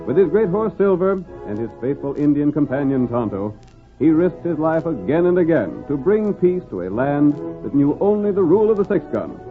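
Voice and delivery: ordinary talk, nothing marked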